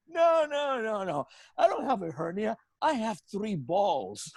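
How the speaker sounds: high-pitched amused denial, dragged at the end; laughing protest. Retold or imitated. Patient correcting the guard about a hernia, laughing. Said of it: "and I'm laughing".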